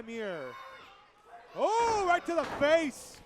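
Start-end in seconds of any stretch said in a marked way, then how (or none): 1.6-3.2 higher, louder, slow, amazed; excited exclamation. Real, not acted. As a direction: react big to the hit. Big kick, excited call.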